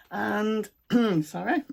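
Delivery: hoarse, strained voice; croaky, losing her voice. Real, not acted. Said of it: "I'm losing my voice".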